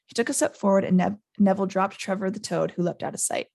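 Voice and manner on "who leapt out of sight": plain reading voice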